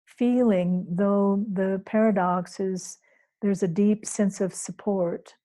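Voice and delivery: ordinary talk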